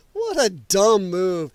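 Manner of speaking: usual speaking voice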